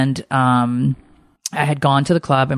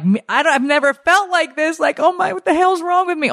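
much higher, a hint of retold panic; alarmed confusion; 14 semitones higher than usual. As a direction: panic lightly about feeling so strange. Feeling strangely drunk at club.

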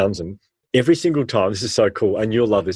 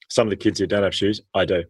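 ordinary talk, nothing marked

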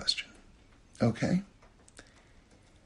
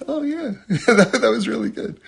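put-on delighted baby; 9 semitones higher, 3 dB louder than usual. Baby discovering ice cream is good, delighted. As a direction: voice the baby's happy surprise. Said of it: "he was like".

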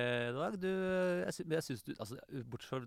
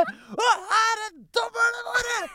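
very high, drawn-out imitation shout; over-loud announcer shout; 11 dB louder, much slower than usual. Co-host mimics her introducing the judges, over-excited loud shout. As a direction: holler the judges' introduction at full blast. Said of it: "og så fortsatte du i samme".

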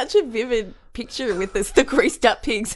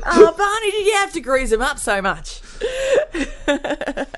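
laughing; 8 semitones higher than usual. Pretend. Voicing character, cracking up.

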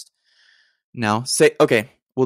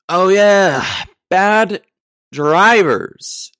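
loud, high, drawn-out mock cheer; hyped-up shout; 10 semitones higher, 10 dB louder, much slower than usual. Podcaster remembering his next topic, hammed-up shout. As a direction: belt out each word like a big reveal.

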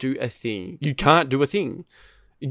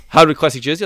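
loud and high, thrilled by the pull; excited announcement; 4 semitones higher, 9 dB louder, faster than usual. Retold or imitated. Friend recounting a pack pull, loud and excited. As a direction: call it out like a big reveal.

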